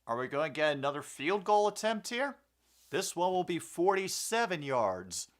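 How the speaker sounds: energetic sportscaster call; play-by-play commentary. Acted. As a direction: call it like a live broadcast. Calls the game play-by-play.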